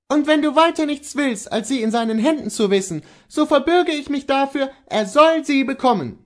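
high, loud, determined wife; insistent wife. Acted. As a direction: vouch for it to your husband with force.

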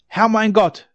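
loud high cry to God; desperate cry. A narrator's reading. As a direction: shout to heaven in despair. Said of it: "schrie er".

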